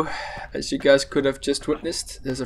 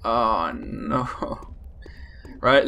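ordinary talk

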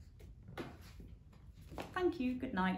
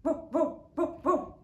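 high, loud barks; dog barking; 9 semitones higher, 6 dB louder than usual. A narrator's reading. Bottomley Potts barks at the yowling, high and loud. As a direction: bark like an excited dog. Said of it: "said Bottomley Potts".

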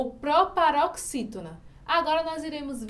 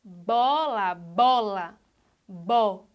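"Bola, bola, bo", BO drawn out, then alone; stress on BO; 4 semitones lower, much slower than usual. Shown as a demonstration. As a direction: sound out "bola", weight on open BO. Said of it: "Qual é a sílaba tônica de bola?". ("bola" destacando o BO, depois só "bo")

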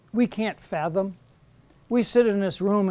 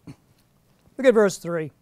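normal talking voice

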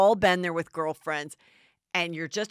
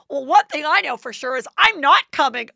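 higher, much louder flat refusal; mock loud declaration; 8 semitones higher, 12 dB louder than usual. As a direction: belt it out like a diva refusing. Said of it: "Lisa Rinna's like".

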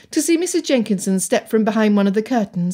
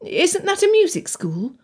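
put-on higher, pleading girl; young girl voice; 10 semitones higher than usual. A narrator's reading. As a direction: voice Anne a bit higher. Anne puzzled.

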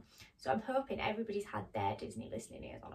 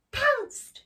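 loud, high narrator outburst; sudden dramatic burst; 13 semitones higher, 10 dB louder than usual. A narrator's reading. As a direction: make the word jump out at the listener. Narrator dramatises the cat's attack.

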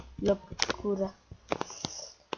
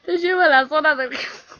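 laughing; 5 semitones higher, 7 dB louder than usual. Real, not acted. Laughing at game mechanic.

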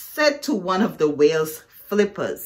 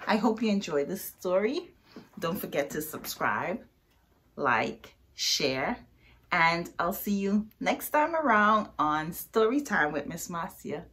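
ordinary delivery, nothing marked

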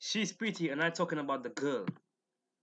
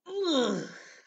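exasperated sigh; 12 semitones higher, 4 dB louder than usual. Real, not acted. Exasperated at the comment.

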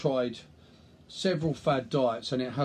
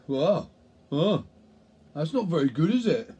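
louder, disapproving busybody; acted tutting critic, pushed louder; mock disapproval; 4 dB louder than usual. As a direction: play a critic judging someone's food. Acting a disapproving onlooker over food.

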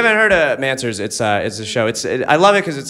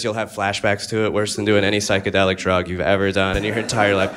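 usual speaking voice

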